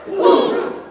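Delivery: huge unvoiced blast, very loud; bazooka boom. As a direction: spit out an explosion noise.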